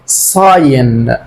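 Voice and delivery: ساين said slowly, with no G sound; silent G in sign. Shown as a demonstration. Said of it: "الجي سايلنت".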